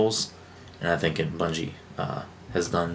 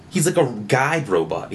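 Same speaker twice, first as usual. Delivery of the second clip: ordinary talk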